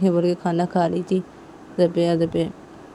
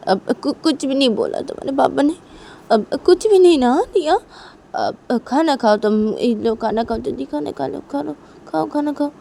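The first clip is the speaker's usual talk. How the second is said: higher, stammering motherly cover-up; flustered reassurance; 8 semitones higher, 5 dB louder than usual. Acted. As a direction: hurriedly hush and coax the child. रोती माँ बच्ची को बहलाती है. (घबराहट में बच्ची को बहलाना)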